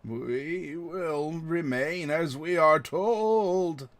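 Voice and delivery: very slow, drawn-out creature voice; hive-mind creature. Acted. Guardian creature refusing to move, slow droning voice. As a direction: stretch every word into an eerie chant.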